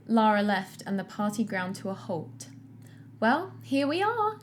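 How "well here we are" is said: warm Julie. Acted. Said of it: "Julie said warmly".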